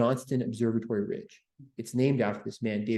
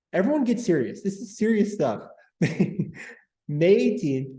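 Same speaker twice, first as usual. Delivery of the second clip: high-pitched plea for seriousness, breaking into laughter; trying not to laugh; 7 semitones higher, 3 dB louder, slower than usual. Real, not acted. Host telling the chat this is serious stuff, failing to hold back laughter. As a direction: fight the giggles and fail. Mock order to be serious, giggling.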